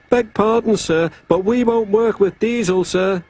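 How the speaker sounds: high-pitched engine voice; Duck the engine, politely objecting. A narrator's reading.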